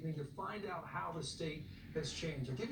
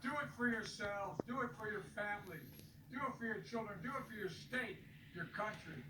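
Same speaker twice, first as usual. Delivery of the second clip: rally speech; 7 semitones higher than usual. Acted. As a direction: rouse the crowd to go vote. Impassioned rally speech to voters.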